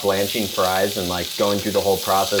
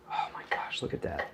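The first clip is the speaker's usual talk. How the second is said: breathless whisper of amazement; awed whisper; 5 semitones lower, 13 dB quieter than usual. Real, not acted. Cook marveling at crispy fries, whispering. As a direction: whisper it, wowed by the food.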